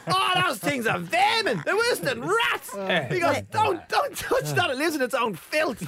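high, slow hammed-up caretaker; mock caretaker warning. Caretaker warning the comedian off the possum, high mock disgust. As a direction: warn with exaggerated disgust.

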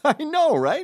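high, loud amused agreement; mock-tickled stranger voice; imitated laughing. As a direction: agree as if cracking up. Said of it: "they start laughing".

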